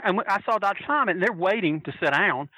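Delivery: ordinary delivery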